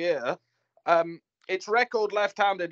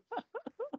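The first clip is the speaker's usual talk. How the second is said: high laugh; 12 dB quieter than usual. Real, not acted. Laughing at own dig.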